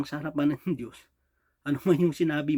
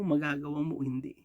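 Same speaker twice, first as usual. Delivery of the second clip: ordinary delivery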